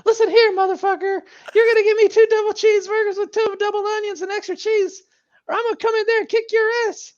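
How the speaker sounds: very high, squealing angry rant; Jim Cornette impression. Mocking Cornette for friends, high squealing rant. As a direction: shriek the threat in a high squeal. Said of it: "Bruce Pritchard's impersonation of Jim Cornette".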